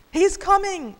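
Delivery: high, loud lookout cry; sparrow calling out. Acted. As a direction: call the warning out high and loud. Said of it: "a sparrow on lookout called".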